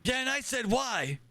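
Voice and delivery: put-on high, insistent old admiral; crotchety old commander explaining. Plays old admiral character.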